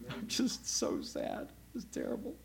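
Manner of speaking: hammed-up sorrow in a breathy hush; mock sadness. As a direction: pretend to be sad with a hollow whisper. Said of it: "Do you think she's gonna go".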